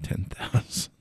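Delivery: awed whisper of the sum. Real, not acted. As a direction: breathe the number out in awe.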